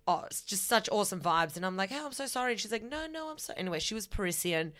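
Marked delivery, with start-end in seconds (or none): 1.9-2.5 high apologetic quote. Retold or imitated. Apologizing after bumping into girl. 2.8-3.5 put-on high, soft girlish reply; mock girl's voice.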